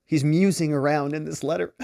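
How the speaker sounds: usual speaking voice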